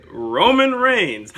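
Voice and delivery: slow booming wrestler introduction; ring announcer intro. Pretend. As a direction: stretch the name like an arena announcer. Hyping a wrestling matchup.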